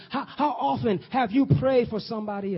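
high, loud preaching, lines flung out; preaching at full force. Acted. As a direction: preach it with fire. Preacher voices Daniel pleading.

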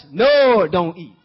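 "nor don't eat" hammered slow and loud; emphatic stress. Acted. Adam overstating the command to Eve, slow and loud. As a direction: drag out "nor don't eat" with heavy weight.

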